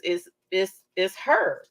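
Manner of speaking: ordinary delivery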